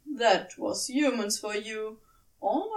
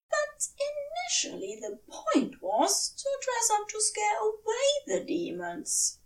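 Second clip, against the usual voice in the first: the prim angel raising an earnest objection; Aziraphale protesting; 12 semitones higher than usual. A narrator's reading. Narrator voicing Aziraphale objecting to Crowley, high and fussy. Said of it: "Aziraphale had protested".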